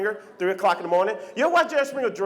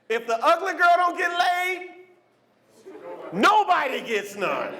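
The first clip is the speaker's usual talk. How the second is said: slow punchline; 7 semitones higher, slower than usual. Real, not acted. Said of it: "punchline slowed".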